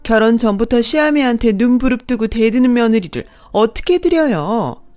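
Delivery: stepmother-in-law appealing to her husband, outraged and whiny; outraged shrill protest. A narrator's reading.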